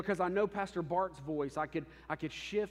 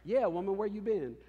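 sassy attitude; 5 semitones higher, 5 dB louder than usual. Retold or imitated. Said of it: "that was basically her attitude".

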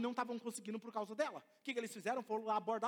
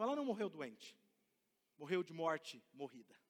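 ordinary talk, nothing marked

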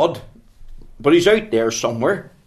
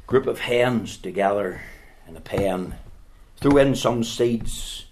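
ordinary talk

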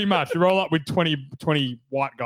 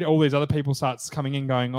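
normal talking voice

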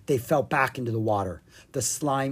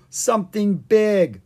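nervous warning; 7 semitones higher, 7 dB louder, slower than usual. Acted. Nervous warning about creature.

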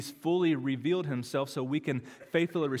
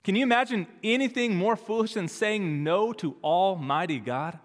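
ordinary delivery

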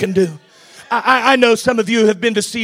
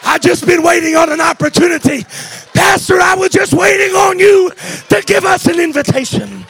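high, loud shout to the church; roaring rallying speech; 8 semitones higher, 5 dB louder than usual. Acted. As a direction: call the crowd up to praise. Urging praise loudly.

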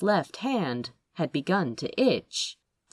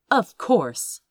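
ordinary delivery, nothing marked

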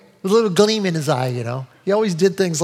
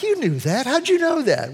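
astonished; 8 semitones higher than usual. Retold or imitated. Astonished at Bob's knowledge.

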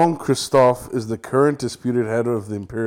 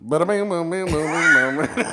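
rattled-off mock-accent gibberish, pitched up; mock American regional accent; 5 semitones higher than usual. Parisians hearing French Canadians, mock regional accent. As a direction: parody the regional accent as nonsense syllables. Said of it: "They're like".

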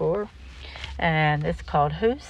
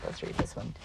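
whisper; 12 dB quieter, faster than usual. Real, not acted. Repeats line in unvoiced whisper.

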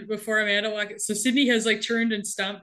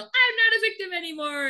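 high mock yell in unison; a touch of a crowd yelling together; group yell; 9 semitones higher than usual. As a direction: chant it back like recruits. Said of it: "they all yell".

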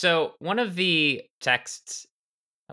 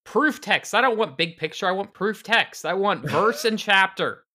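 slow, high, insistent whining demand; stubborn demand; 7 semitones higher, 4 dB louder than usual. Pretend. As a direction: whine it like a stubborn literalist. Mock demand for proof texts.

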